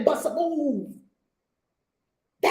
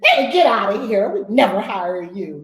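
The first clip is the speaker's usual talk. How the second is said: mock boss yell; 3 semitones lower, 8 dB louder than usual. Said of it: "Let's hear you bark at people".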